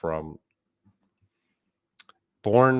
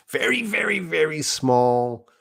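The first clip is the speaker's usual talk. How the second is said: very high, tiny little voice; 9 semitones higher, 4 dB louder than usual. Shown as a demonstration. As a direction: shrink it into a high-pitched miniature voice. Voice illustrates a tiny fight.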